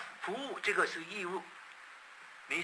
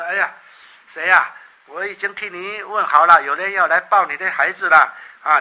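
loud, eager news for the mother; teasing announcement; 12 dB louder than usual. Acted. Pastor loudly teasing the mother that someone will take her baby. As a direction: call it out as if it's great news.

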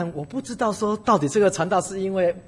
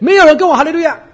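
loud exclaim; 10 semitones higher, 14 dB louder than usual. Real, not acted. Preacher exclaiming to the church, loud and high. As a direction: pitch jumps high and loud in preaching indignation. Preacher stresses nobody answered.

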